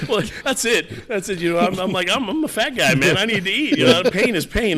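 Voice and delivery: amused, laughing confession. Real, not acted. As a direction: chuckle through the self-mocking excuse. Laughing at own fat-guy joke.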